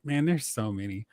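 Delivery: ordinary talk, nothing marked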